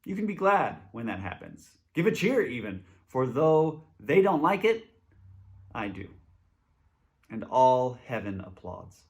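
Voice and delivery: ordinary talk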